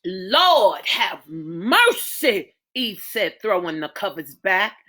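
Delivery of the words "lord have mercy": mother waking to her daughter's labor cries; slow dread. Acted. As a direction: let the words sink, very slowly.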